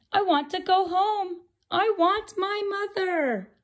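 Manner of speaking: high, plaintive plea; sad baby bird. Acted. Bird wanting to go home, high plaintive chick. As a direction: plead like a lost chick.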